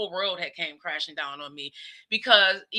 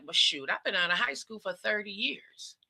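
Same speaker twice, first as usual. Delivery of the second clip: breathy whisper of mock shock; whispered aside; 6 dB quieter than usual. Mom whispering her reaction to kids' chat. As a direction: whisper it like a secret to the camera.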